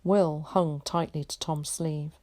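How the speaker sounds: plain reading voice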